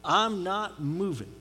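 slow, sulky mock three-year-old; stubborn toddler. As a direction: dig your heels in like a pouting child. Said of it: "we just plop down like a three-year-old".

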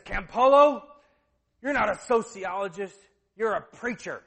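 retold loud, indignant challenge; confronting shout. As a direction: act out getting right in someone's face. Hank confronts him in his face.

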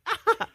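put-on high laughing baby wail; imitated baby cry. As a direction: mimic the crying newborn through a laugh. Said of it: "pictures and videos I have are like".

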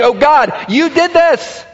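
high, loud sulky whine; pouting. Acted. Jonah pouting outside the city at God, a loud high whine. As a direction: whine it like a sulking child. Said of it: "he's pouting and he's saying".

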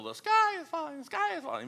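high, squawky panicked cry; Chicken Little panic. Retold or imitated. Chicken Little panicking about the sky, high pitched. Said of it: "like Chicken Little".

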